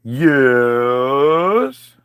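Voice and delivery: long low dopey yes; dim Eve. Retold or imitated. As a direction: stretch it like a simpleton. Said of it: "Eve answers snake".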